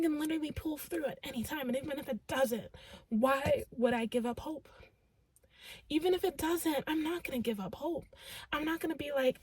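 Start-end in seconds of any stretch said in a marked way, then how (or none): none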